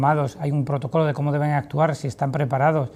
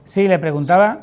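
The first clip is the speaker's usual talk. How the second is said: speaking up; 3 semitones higher, 7 dB louder than usual. Real, not acted. Repeats question over broken line.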